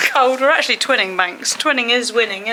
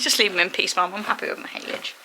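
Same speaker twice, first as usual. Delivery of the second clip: quiet, softer horse's voice, content and munching; lightly voiced horse telling Mum to leave him; voicing her horse; 5 semitones lower, 7 dB quieter than usual. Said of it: "He says".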